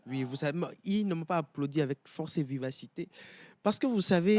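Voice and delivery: loud. Real, not acted. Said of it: "Vas-y, répète un peu".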